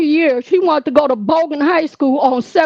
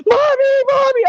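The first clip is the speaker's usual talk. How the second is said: much higher, imitated crying wail; crying for mommy; 10 semitones higher than usual. Daughter crying to her mother on the phone, imitated high wail. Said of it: "My child on the phone crying".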